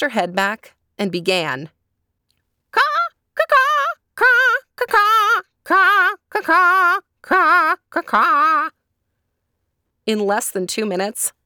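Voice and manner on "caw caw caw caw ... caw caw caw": high, loud squawking caws; loud crowing caws. Acted. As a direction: belt out the caws. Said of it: "start a merry tune".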